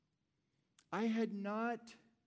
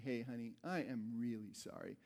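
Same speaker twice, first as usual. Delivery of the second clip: soft apology; 7 semitones lower, 6 dB quieter than usual. Retold or imitated. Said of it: "talk to Catherine that night and say".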